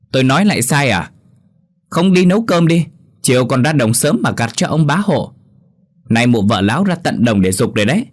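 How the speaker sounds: glaring wife hitting back at her husband; angry wife's rant. Acted. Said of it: "đập tay xuống bàn, trừng mắt với chồng".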